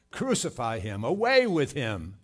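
building loud crowd cry; angry mob shout. Acted. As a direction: call for condemnation, rising to a shout. Voicing the crowd condemning Christ.

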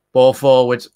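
sudden loud call of the ball forward; calling the play. Real, not acted. Fan watching live calls the play, loud. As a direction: bark it out as the ball goes long.

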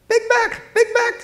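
piercing, very high parrot squawk. A narrator's reading. Crow parrots at dinner time, squawking piercingly. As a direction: screech it like a raucous bird. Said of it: "squawking so piercingly you could hear".

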